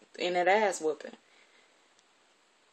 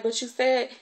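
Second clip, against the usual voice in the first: put-on higher, louder child's protest; pleading protest; 6 semitones higher, 5 dB louder than usual. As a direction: plead your case, voice raised. Crying child protests to guardian.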